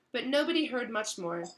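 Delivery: plain reading voice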